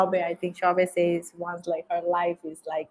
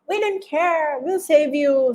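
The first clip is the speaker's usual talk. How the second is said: pitched well up, a lightly acted bold reassurance; mock bold promise; 9 semitones higher, 4 dB louder than usual. Interviewee imitating the girls' carefree bravado, mock bold. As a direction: play the girls brushing off danger, high and light. Girls' brave reply, retold.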